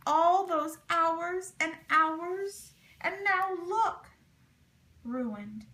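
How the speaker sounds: slow lament, fading on ruined; moaning. A narrator's reading. Sam lamenting the ruined quilt to the men, slow moaning. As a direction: grieve the lost hours. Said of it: "Sam Johnson moaned".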